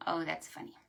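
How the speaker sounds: plain reading voice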